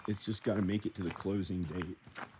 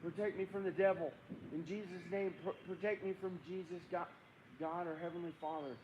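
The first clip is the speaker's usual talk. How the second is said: high, pleading prayer for protection; hammed-up plea to heaven; mock frightened prayer; 8 semitones higher, 5 dB quieter than usual. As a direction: pray in pretend panic, pitched high. Mock-frightened prayer against the devil.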